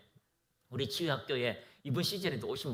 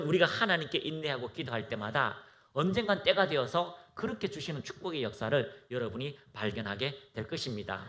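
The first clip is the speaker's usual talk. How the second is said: ordinary talk, nothing marked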